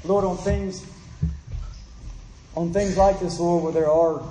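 soft, low pastoral prayer; hushed, reverent prayer voice; closing prayer. Acted. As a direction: pray quietly over the congregation.

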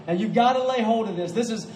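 ordinary talk

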